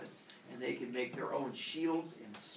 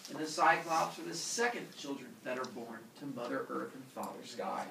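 plain reading voice

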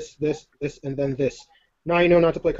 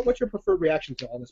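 ordinary talk

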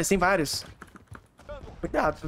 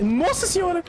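panicking gamer; 11 semitones higher than usual. Real, not acted. Sudden firefight in the game.

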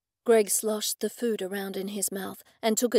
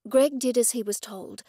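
ordinary delivery

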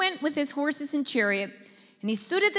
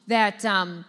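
ordinary talk

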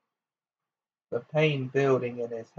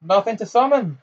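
high jeering shout; shouted taunt; 8 semitones higher, 4 dB louder than usual. A narrator's reading. Harry mocks Bellatrix's summoning spell, shouting. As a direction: shout it out, pitched up. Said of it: "he shouted".

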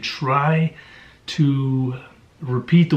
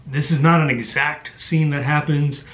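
ordinary delivery

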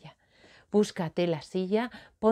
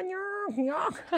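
creaking noise; 11 semitones higher, 5 dB quieter than usual. Pretend. Imita el ruido de la silla.

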